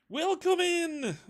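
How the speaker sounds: high, slow, sing-song welcome; drawn-out theatrical welcome; grand greeting. Acted. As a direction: call it out like a beaming hostess. Cult leader greets the visitors.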